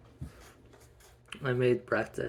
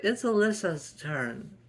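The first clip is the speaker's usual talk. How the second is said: put-on whiny child; 8 semitones higher, 6 dB louder than usual. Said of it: "She's like".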